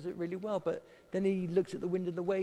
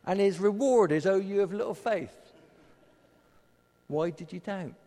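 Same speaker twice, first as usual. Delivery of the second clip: much louder, higher, stung complaint; loud mock indignation; louder mock outrage; 5 semitones higher, 9 dB louder than usual. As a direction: build to an outraged punchline. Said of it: "I used to really hate that".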